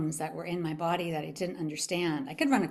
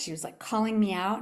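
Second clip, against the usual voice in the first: normal talking voice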